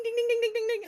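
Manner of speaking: high, rapid-fire dinging; rapid message dings. Retold or imitated. Editor's messages flooding in, imitated as high dings. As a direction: imitate a phone pinging nonstop.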